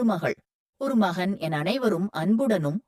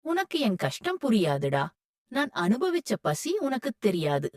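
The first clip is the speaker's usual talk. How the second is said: sighing; 8 dB quieter than usual. A narrator's reading. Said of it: "பெருமூச்சு விட்டு".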